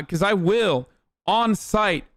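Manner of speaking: high-pitched, indignant warning; indignant threat. Real, not acted. Streamer threatening teasing sports fans with bans, indignant.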